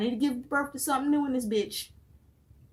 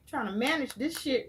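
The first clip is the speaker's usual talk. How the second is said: ordinary delivery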